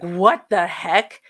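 loud, drawn-out mock outrage; indignant. Woman retelling her reaction to being smacked, loud and indignant. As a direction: stretch each word out, loudly affronted.

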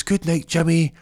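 put-on high child voice; young boy. Benji bids Jimmy goodnight, high child voice. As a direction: sing out a little boy's goodnight. Young son's line in acted story.

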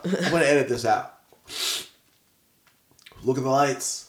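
ordinary delivery

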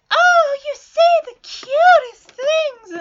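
high, loud, drawn-out adoring squeal; gushing lovestruck girl. Acted. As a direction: gush it slowly, way up high. Sally gushing, hearts above her head.

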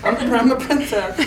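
laughing prissy princess voice; mock princess. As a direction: parody the girl's dreamy pose. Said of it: "mocking the photo".

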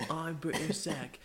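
high, loud, drawn-out mock scream in British accent; British Zach. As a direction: yell it in a silly fake British voice. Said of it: "like, screaming".

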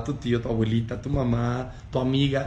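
ordinary delivery

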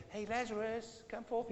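casual, feeble call. Acted. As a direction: say it meekly, as if barely bothering to call. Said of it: "He didn't just stand there and say".

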